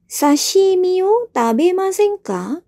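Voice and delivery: a teacher acting out a polite invitation; modelled invitation. Shown as a demonstration. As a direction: lift your voice and offer it slowly. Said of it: "kata kerja imasen ka".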